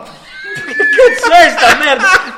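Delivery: loud, slow, laughing outburst; laughing shout. Real, not acted. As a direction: blurt it out in mock outrage. Outburst at the script. (urlo tra le risate)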